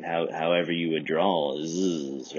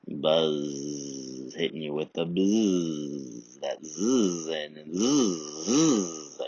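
buzzing; much slower than usual. Shown as a demonstration. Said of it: "imitating buzz sound".

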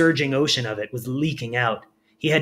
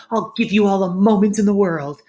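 giddy Firion; 7 semitones higher, 6 dB louder than usual. A narrator's reading. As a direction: savour every word with delight.

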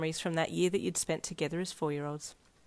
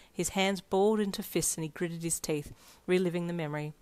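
ordinary delivery

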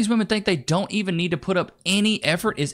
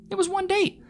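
high, disbelieving; high incredulous protest. Real, not acted. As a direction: say it as if it's absurd. Incredulous exclamation.

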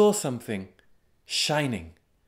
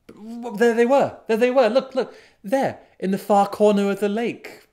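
higher, louder burst of excitement; excited sighting; 9 semitones higher, 6 dB louder than usual. A narrator's reading. Narrator spots the dancers on the lake, excited. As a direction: narrate it as a thrilled sudden discovery.

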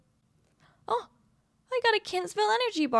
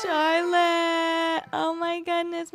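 mock wail; 6 semitones higher, 4 dB louder, much slower than usual.

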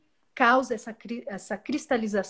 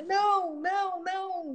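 quick high "não, não" pushing it away; high refusal; 9 semitones higher than usual. Retold or imitated. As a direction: refuse it in a higher voice. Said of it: "E aí você". (recusa aguda e rápida)

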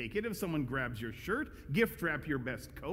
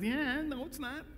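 a breezy, dismissive "no"; 9 semitones higher than usual. Retold or imitated. Jesus voiced answering Pharisees.

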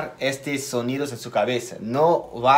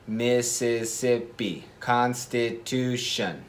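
slow, syllable-counting delivery of Independence and Mississippi; 3 dB quieter, much slower than usual. Shown as a demonstration. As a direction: tap out each syllable slowly. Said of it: "contar las sílabas".

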